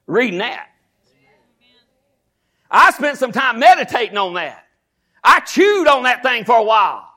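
loud, high, shouted preaching; preaching at full force. Acted. Pastor rousing his congregation about bills, shouted preaching. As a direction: build the church up, pulpit fire.

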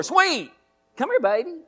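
high cooing voice coaxing a dog; pet-talk baby voice calling the dog; sweet talk to a dog. Retold or imitated. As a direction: coo at your little dog, way up high. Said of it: "I'm talking to her".

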